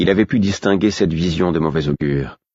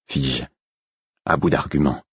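ordinary delivery

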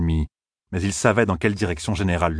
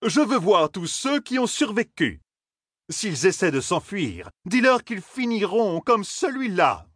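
menacing villain; 13 semitones higher, slower than usual. A narrator's reading. Fane commanding his followers.

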